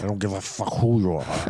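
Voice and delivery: low, soft grumbling tough guy; Will Darnell. Retold or imitated. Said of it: "introduced to Will Darnell".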